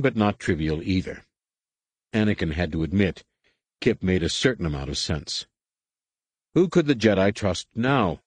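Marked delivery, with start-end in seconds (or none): none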